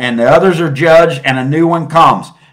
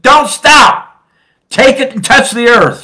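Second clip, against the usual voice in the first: high, loud urgent command; retold scream; 8 semitones higher, 5 dB louder than usual. The guide in the vision yells at him not to stop, loud shout. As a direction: shout it like a sudden order to keep going. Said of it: "And he screamed at me".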